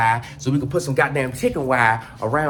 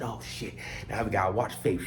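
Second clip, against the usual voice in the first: hushed worry; 7 semitones lower, 8 dB quieter than usual. Retold or imitated. Hushed worry over the dog.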